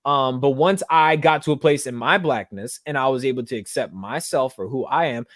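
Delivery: ordinary talk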